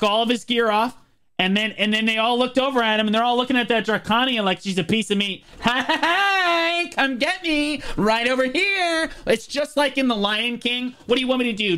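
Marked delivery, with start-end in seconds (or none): 5.6-9.1 coy bait voice. Pretend. Baiting enemies, voicing the bait.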